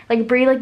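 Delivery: breathy relieved exhale; sigh of relief. Real, not acted. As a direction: let out a huge sigh of relief. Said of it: "the biggest sigh of relief".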